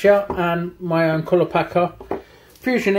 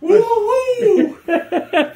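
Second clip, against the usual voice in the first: triumphant woo-hoo after finishing the job; excited whoop; 14 semitones higher than usual. Real, not acted. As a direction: whoop it out for the camera. Celebrating the day's work.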